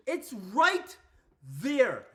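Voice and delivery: loud, broken-up emphasis on "right there"; emphatic insistence. Real, not acted. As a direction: hammer it home word by word. Emphatic repeat, building.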